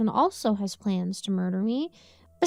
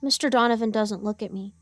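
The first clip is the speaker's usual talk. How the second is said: usual speaking voice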